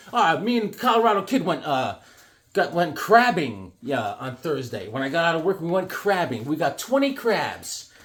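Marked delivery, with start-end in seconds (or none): none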